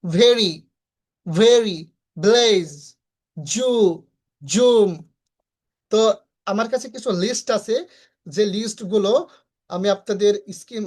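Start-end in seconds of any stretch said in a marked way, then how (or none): 0.0-4.9 ভেরি and জু said one at a time, distinctly; careful word drill with clear V in ভেরি, Z in জুম; modelled pronunciation. Shown as a demonstration. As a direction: stretch each word from ভেরি to জুম for learners. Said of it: "এরকম উচ্চারণ করবেন".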